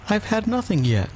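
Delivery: high-pitched huffy reply; offended. A narrator's reading. Alice to the March Hare, offended. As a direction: object in a high, affronted voice. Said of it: "in an offended tone".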